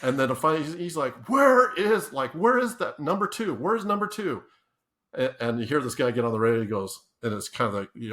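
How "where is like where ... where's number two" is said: mock frantic call. Boss radioing for the lost dummy.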